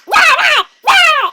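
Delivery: loud, high shouted roars; hammed-up attack roar; beast roar. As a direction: roar like a comic monster. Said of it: "he goes".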